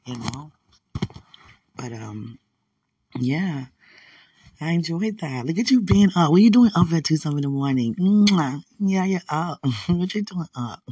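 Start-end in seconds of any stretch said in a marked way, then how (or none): 5.5-10.8 loud, high, delighted teasing; excited greeting. Real, not acted. Greeting a late-night viewer with loud delight. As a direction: tease him loudly for being up.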